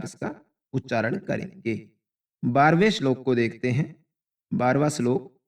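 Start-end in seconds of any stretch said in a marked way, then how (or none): none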